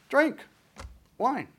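high, drawn-out invitation to drink; inviting offer. Acted. Jeremiah offering wine to the Rechabites, coaxing. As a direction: sing out the offer.

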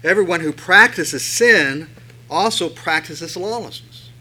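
loud, slow reading of the verse; scripture reading. Acted. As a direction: bring the verse down hard. Rereads verse four with force.

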